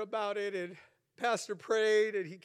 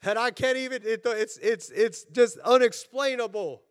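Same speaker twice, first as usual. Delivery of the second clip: raised, emphatic pulpit voice; punchy preacher's proclamation; fervent preaching; 6 semitones higher, 5 dB louder than usual. Real, not acted. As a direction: preach it with urgent conviction. Preaches with force.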